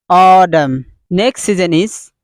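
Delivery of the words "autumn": "Autumn" stretched out as AWW-dum; correct American pronunciation. Shown as a demonstration. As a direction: lengthen the first vowel of "Autumn", flap the T.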